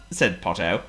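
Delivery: ordinary delivery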